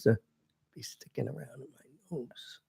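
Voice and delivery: fading off the microphone; turned away from mic. Real, not acted. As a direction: voice drifting off mic. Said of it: "now you're off mic".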